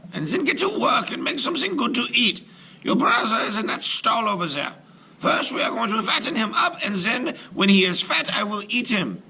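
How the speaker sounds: high, harsh crone voice barking orders; witch yelling. Acted. Witch rousing Gretel with orders, high crone yell. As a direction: boss the girl like a cruel old witch.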